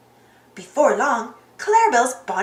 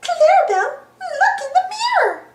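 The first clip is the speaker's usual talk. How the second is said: very high, loud squeaky mouse; high cartoon excitement; excited Minnie; 4 dB louder than usual. A narrator's reading. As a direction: squeal it with delight. Minnie excitedly tells Clarabelle.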